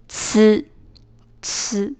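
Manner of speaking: "呲" as t and s fused into ts; merged ts sound. Shown as a demonstration. Teacher showing t and S together, "呲" as ts. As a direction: say "呲" twice, a sharp voiceless ts. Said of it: "共同发音是呲".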